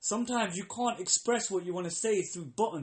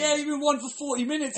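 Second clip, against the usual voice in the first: higher, louder outraged complaint; exasperated mock; 9 semitones higher, 7 dB louder than usual. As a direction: vent at the endless wait. Exasperated, mocking the wait.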